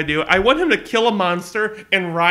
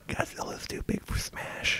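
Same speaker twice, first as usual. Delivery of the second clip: breathy whispered aside; whispering; 16 dB quieter than usual. Real, not acted. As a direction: drop to a whisper.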